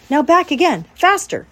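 high, loud coaching call; calling instructions. A narrator's reading. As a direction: push him to speed up. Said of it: "said Charlotte".

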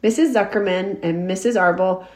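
usual speaking voice

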